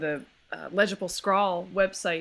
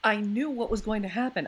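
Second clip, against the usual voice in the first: ordinary talk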